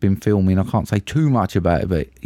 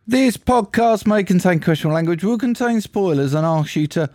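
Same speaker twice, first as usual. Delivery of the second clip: announcer-style warning, higher; disclaimer read; 7 semitones higher than usual. Acted. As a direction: read it like a formal notice.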